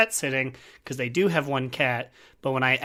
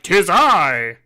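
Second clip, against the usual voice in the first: confident declaration; 3 semitones higher, 8 dB louder than usual. Retold or imitated. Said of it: "just confidence, like".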